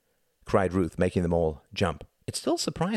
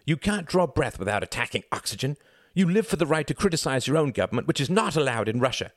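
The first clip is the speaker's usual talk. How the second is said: higher-pitched exasperated scolding; snapping; 8 semitones higher than usual. A narrator's reading. Mother attacking Reginald's politics, snappish. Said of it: "snapped Ruth".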